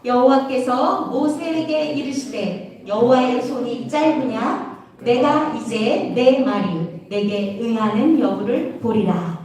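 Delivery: slow, low, measured reading aloud; verse recitation. Real, not acted. Preacher leading children in memory verse, slow recitation. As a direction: lead the verse slowly, phrase by phrase.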